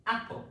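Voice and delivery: apple with the a of apple drawn out and sung high; stressed first vowel. Shown as a demonstration. Teacher models the vowel in a word, drawn-out apple. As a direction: stretch the ah in apple. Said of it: "A word with ah is".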